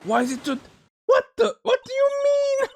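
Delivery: very high, loud indignant shriek; hammed-up squawk of disbelief; explode, pitch shooting way up; acted outburst. Witness erupts in acted outrage.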